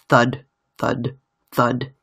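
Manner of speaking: ordinary delivery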